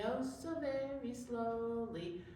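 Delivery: normal talking voice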